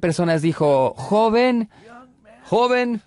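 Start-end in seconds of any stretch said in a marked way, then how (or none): 1.0-1.7 high, raised call of "Joven"; Fuller scolding the young man. Retold or imitated. Elder rebuking a young man before the crowd, raised high. 2.4-3.0 "joven" repeated high and raised; Fuller scolding again. Retold or imitated. Fuller pressing the young man in public, high call.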